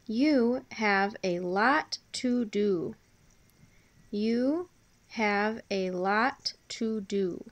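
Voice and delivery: drawn out, word by word; careful, stretched-out teacher's dictation; slow dictation. Shown as a demonstration. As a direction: dictate slowly so pupils can write. Teacher dictating sentence slowly.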